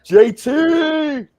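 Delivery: high, loud shout of welcome; excited cheer. Real, not acted. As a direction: greet a mate like a crowd cheering. Greeting the guest being introduced.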